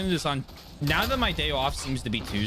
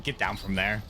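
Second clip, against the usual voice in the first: normal talking voice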